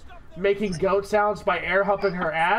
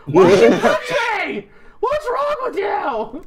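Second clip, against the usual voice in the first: mock wailing shout; 12 semitones higher, 7 dB louder than usual. Husband faking injury after wife's bump, mock wailing shout. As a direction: play the victim for onlookers, hammed-up. Acts out faking being hit.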